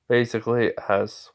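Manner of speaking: usual speaking voice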